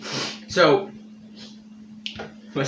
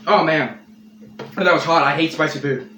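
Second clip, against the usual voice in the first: normal talking voice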